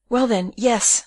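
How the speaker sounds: anxious, placating assent; all in a tremble. A narrator's reading. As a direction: give in, frightened and flustered. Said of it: "all in a tremble".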